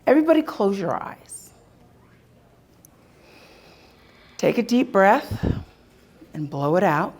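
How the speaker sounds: guided meditation. Acted. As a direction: gently talk them through it. Leads a visualization exercise.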